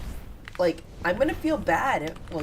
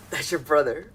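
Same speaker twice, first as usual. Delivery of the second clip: ordinary talk, nothing marked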